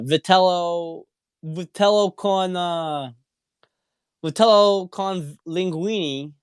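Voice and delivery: hammed-up Jersey Italian accent on the menu. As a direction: savour vitello con linguine like a Jersey paisan. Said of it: "I'll do my Jersey accent".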